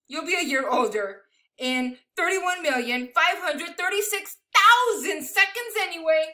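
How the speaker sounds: animated, emphatic picture-book reading; big numbers stretched out for effect; read-aloud to children. Acted. Reading a picture book of amazing numbers to children, animated storytelling.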